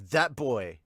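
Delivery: normal talking voice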